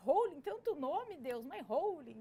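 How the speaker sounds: exasperated protest. Retold or imitated. Complaining to God about name.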